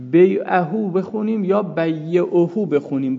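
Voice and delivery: first بیعهو as bay'uhu, then بیعهو as bayyi'uhu with a doubled y; بیعهو said both ways, the second with a doubled y; with and without shadda. Shown as a demonstration. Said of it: "با تشدید بخونیم یا بدون تشدید".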